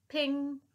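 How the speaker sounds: high sharp ping; ping like a dart hitting. A narrator's reading. Sound effect.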